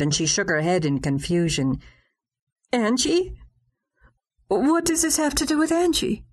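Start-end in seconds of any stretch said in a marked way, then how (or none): 2.7-6.2 high, stunned question; shocked. Acted. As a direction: gasp out the name. Shocked at sister mentioned.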